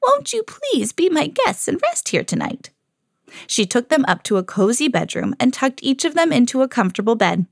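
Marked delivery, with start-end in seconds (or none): 0.0-2.7 high, sweet storybook invitation; gentle old woman. A narrator's reading. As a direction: coax them politely in a high voice. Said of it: "who had seemed so gentle".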